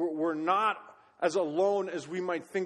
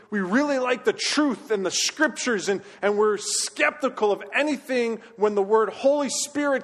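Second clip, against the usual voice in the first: preaching; 3 semitones higher, 5 dB louder than usual. Acted. Preaches at rising force.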